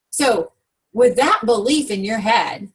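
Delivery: normal talking voice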